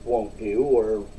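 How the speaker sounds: normal talking voice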